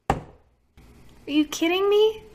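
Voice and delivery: high, slow, drawn-out disbelief; slow, high, stunned "me"; incredulous. Real, not acted. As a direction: stretch out "Are you kidding me?" high and slow in real shock. Disbelief at mineral oil.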